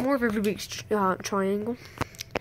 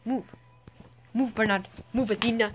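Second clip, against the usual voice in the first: ordinary talk, nothing marked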